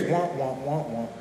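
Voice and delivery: soft muffled wah wah of the unseen teacher; Charlie Brown adult drone. Retold or imitated. Preacher mimics the Charlie Brown teacher, soft wah drone. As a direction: let the wahs fade away softly, a soft imitation. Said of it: "the Charlie Brown effect".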